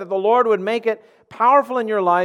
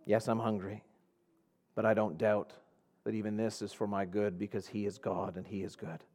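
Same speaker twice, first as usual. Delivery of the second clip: quiet trust; 9 semitones lower, 10 dB quieter than usual. Acted. Quiet trusting reply, softer, slower.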